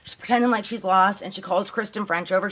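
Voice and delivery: normal talking voice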